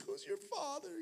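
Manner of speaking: the stunned biker asks, in a high soft voice; weeping biker asking. Retold or imitated. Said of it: "The guy's like".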